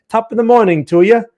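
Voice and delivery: high-pitched, badly faked Irish greeting; attempted Irish accent. Streamer playfully greeting a viewer from Ireland, attempted Irish accent. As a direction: have a go at a hammed-up Irish accent. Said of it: "can't really do an Irish accent".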